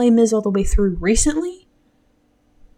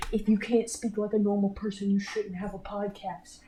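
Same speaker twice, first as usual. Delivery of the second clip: quiet; 10 dB quieter than usual. Pretend. Mock heckler voice.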